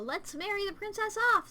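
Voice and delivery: mock the royal plan in a lilting voice; mocking quote. Mocking the kingdom's plan to marry off the princess, sing-song.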